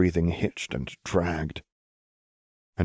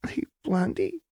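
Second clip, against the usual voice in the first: higher, hollow and distant; hollow and wet; 13 semitones higher than usual. A narrator's reading. Jinx calling Lux in a hollow, distant voice. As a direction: sound far away and wet. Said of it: "Jinx's voice was hollow and wet".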